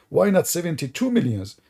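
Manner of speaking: ordinary delivery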